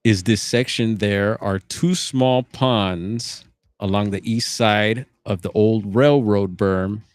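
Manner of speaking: ordinary delivery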